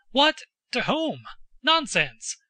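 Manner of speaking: high, incredulous burst of questions; startled cry. A narrator's reading. Father to son over the lost money, startled cry. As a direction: cry out in disbelief, pitch jumping on the first word. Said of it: "cried the Count".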